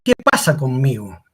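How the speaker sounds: ordinary delivery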